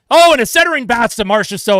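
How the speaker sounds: rising commentator excitement; excited call. Acted. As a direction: surge on the scoring chance. Scoring chance call.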